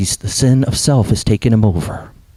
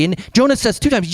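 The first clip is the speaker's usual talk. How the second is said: normal talking voice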